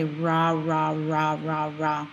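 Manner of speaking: "ra" repeated evenly, tongue touching nothing; English R. Shown as a demonstration. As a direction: drill "ra" with a loose English r. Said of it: "start off with the English R".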